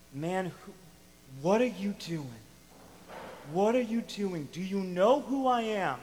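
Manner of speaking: exasperated rebuke. Retold or imitated. Voicing Jesus rebuking the man.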